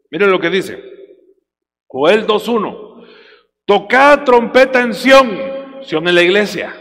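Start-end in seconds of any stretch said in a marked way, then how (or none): 3.7-5.4 slow, loud scripture proclamation; preaching at full force. Acted. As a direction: thunder the verse to the church. (predicación lenta y fuerte)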